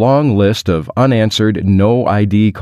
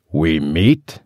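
put-on French accent; 4 semitones lower than usual. A narrator's reading. French caller interrupting with a suggestion, low French accent. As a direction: lower French-accented question. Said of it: "A deep male voice in a French dialect".

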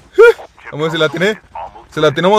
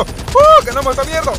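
victory whoop. Real, not acted. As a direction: celebrate the win. Wins the match.

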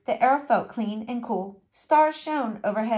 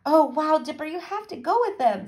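excited urging; 6 semitones higher than usual. A narrator's reading. Annie urges Dipper to take the gig.

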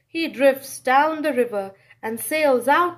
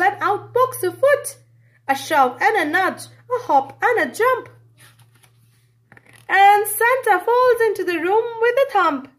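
faster and louder to a crash; building excitement; 7 semitones higher, 5 dB louder than usual. A narrator's reading. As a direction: build up to the thump. Building action climax.

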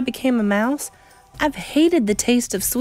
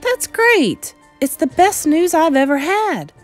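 put-on overjoyed boy, high and loud; excited cry; 9 semitones higher, 6 dB louder, slower than usual. A narrator's reading. Grandson delighted at his lifespan, high loud cry. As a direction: exclaim it with childish delight. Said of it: "I cried".

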